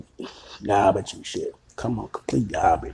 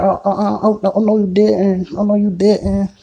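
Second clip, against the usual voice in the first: higher, louder sassy "oh no you didn't"; outraged woman imitation; 9 semitones higher, 8 dB louder than usual. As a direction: play her outrage, high and loud. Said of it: "She said".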